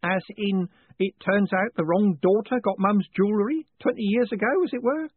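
put-on high, fussy old postman hinting darkly; old man warning his boss of trouble; meaningful old Grote. A narrator's reading. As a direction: lean on the hint like a worried clerk. Grote spells it out.

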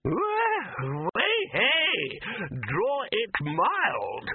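high, cracking wizard cry; startled old wizard. A narrator's reading. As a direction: yelp it out, then slow right down.

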